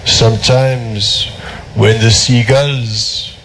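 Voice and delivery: put-on slow, heavy French accent. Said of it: "preposterous French accent".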